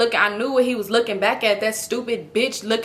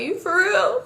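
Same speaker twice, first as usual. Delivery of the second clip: high, hopeful disbelief; surprised question; 9 semitones higher, slower than usual. Retold or imitated. To her boyfriend after his promise: hopeful high surprise. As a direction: ask it like you can barely believe it. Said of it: "So I was like".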